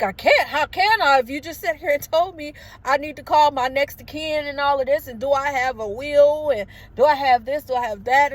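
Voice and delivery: overwhelmed protest, re-enacted without real sobbing; retold tearful panic. Patient answers staff telling her to calm down, acted panicked protest. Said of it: "I'm crying".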